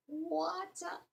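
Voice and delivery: very high, soft squeak; a tiny mocking peep; squeaky high water. Shown as a demonstration. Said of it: "more like a giant voice".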